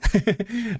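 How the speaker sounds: brief high-pitched laugh; short laugh. Real, not acted. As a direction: laugh lightly at your own joke. Joking with the viewers.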